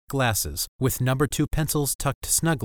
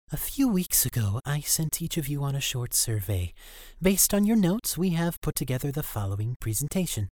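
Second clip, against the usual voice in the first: higher, smug schoolgirl; girl presenter voice; 5 semitones higher than usual. Acted. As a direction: present it smugly. Elizabeth presents to class.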